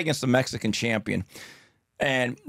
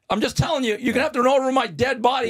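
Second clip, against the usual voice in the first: high, fast defiance; defiant dare; 9 semitones higher, faster than usual. Retold or imitated. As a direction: tell them flatly they'll have to run you over. Bill blocking the car.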